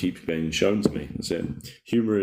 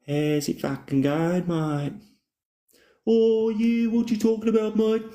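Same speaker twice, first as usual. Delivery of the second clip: mock Aussie bloke; slower than usual. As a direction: greet your mate like a cartoon bloke. Jokey put-on voice to viewer.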